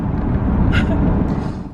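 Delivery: short laugh through tears; tearful breathy laugh; teary laugh. Real, not acted. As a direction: laugh it off after crying.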